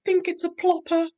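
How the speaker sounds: high, loud little-bear voice; Little Bear telling Big Bear his guess, high and loud; Little Bear excited. Acted. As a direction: squeak it out, sure of himself. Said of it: "said Little Bear".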